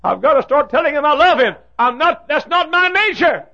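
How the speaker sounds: high, loud, pleading voice; a troubled father pleading with his preacher; pleading man. Retold or imitated. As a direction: beg the preacher in earnest. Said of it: "A man ... said".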